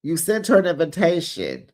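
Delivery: normal talking voice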